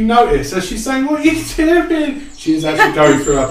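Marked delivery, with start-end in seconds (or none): 1.1-2.2 high squeaky scolding of a dead friend; high scolding grief. Acted. The elf scolds her dead mate in a squeaky high voice.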